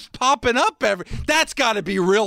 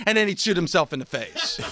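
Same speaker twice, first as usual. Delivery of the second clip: ordinary talk, nothing marked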